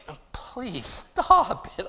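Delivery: slow, laughing-through-it plea. Retold or imitated. Pastor retelling crying at the wedding, slow laughing plea. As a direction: beg through your own laughter, drawn out. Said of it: "and so I'm just like".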